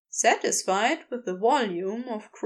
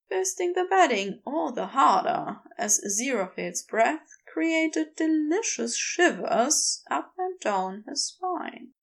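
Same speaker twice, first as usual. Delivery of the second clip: usual speaking voice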